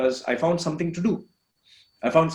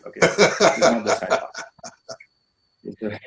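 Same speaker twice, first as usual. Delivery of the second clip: laughing through words; 4 semitones lower, much slower than usual. Real, not acted. Laughs off getting emotional.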